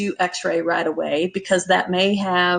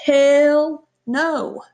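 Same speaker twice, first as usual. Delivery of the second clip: high, drawn-out emphatic refusal; 7 semitones higher, 3 dB louder than usual. Acted. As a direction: refuse outright, stretching both words up high. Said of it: "that answer needs to be".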